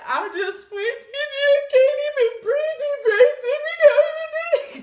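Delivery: high, loud, wailing sob-talk; imitating crying. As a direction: blubber it out like a crying baby. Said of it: "She was like".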